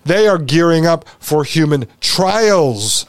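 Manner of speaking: ordinary talk, nothing marked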